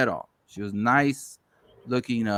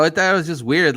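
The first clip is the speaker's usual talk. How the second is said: ordinary delivery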